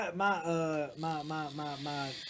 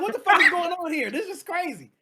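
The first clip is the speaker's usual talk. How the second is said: loud, higher-pitched mock disbelief; retold bewilderment; 7 semitones higher, 6 dB louder than usual. Man retelling his shock at a [name] concert, loud bewilderment. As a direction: raise the voice as if baffled by the crowd.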